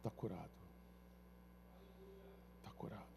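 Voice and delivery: preacher softly declaring the healing; hushed declaration. Shown as a demonstration. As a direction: let it land quietly.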